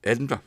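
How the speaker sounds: Edinburgh with a quick schwa, ed-in-bruh; Edinburgh said smoothly, middle syllables short; fluent Edinburgh. Shown as a demonstration. Teacher demonstrating the fluent Edinburgh. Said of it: "pronounced in general when you're speaking fluently".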